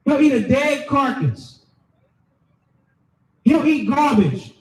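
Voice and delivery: normal talking voice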